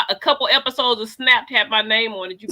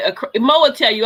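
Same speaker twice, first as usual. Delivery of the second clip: normal talking voice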